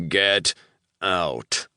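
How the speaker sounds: snarled. A narrator's reading. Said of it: "I snarled".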